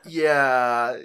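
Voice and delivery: loud excited yeah; excited shout. Retold or imitated. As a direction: belt it out excitedly. Excited recap of fight.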